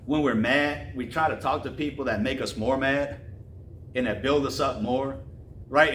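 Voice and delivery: ordinary talk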